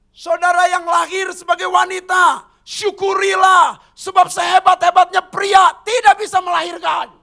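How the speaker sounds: high, loud preaching exhortation; full-force preaching. Acted. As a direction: proclaim it to the women with force.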